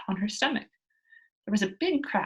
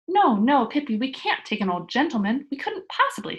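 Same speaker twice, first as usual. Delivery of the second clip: put-on loud, much higher alarmed boy; horrified protest; 3 semitones higher, 6 dB louder than usual. A narrator's reading. Tommy protesting to Pippi, loud horrified boy. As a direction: cry out in shock at the idea.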